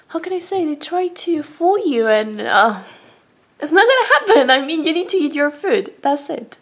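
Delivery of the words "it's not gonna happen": it's not GONNA happen, high and loud; adamant denial. Real, not acted. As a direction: put your foot down. Adamant about pet food.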